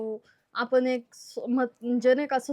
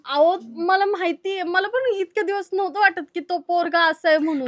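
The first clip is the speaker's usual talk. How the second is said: raised, higher-pitched insistence; insistent arguing; 6 semitones higher, 5 dB louder than usual. Acted. Daughter-in-law arguing with doubting in-laws, raised and insistent. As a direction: argue the point in a raised voice.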